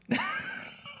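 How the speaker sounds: shrieking laugh; high burst of laughter. Real, not acted.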